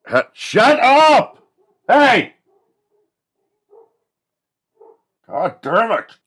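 high, loud, drawn-out yokel holler; slack-jawed yokel. Acted. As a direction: holler like an outraged backwoods yokel. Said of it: "these fucking slack-jawed yokels".

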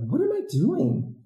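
high, drawn-out what-was-I-thinking; sweating teacher realizing the dress shoes were a mistake; exasperated disbelief. Retold or imitated. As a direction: stretch it out, baffled at yourself. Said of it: "I'm like".

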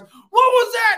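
very high, loud startled question; shocked guard. Retold or imitated. Security guard seeing the chop, high loud alarmed question. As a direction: blurt it out in disbelief. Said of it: "the security guards".

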